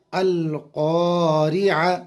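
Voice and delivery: अल क़ारिआ drawn out slowly with a clear ain; slow spelled recitation. Shown as a demonstration. Teacher sounds the word for students, slowly. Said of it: "ऐन पर ज़बर है".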